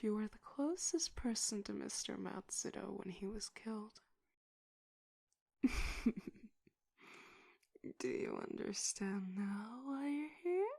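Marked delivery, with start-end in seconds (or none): 5.6-6.5 sly chuckle. Acted. Interrogator laughs at suspect.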